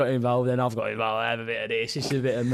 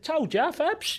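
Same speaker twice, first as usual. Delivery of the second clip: much higher, gloating taunt; mock smug gloat, pitched up; smug told-you-so; 13 semitones higher, much slower than usual. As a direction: rub it in to the coach. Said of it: "he said".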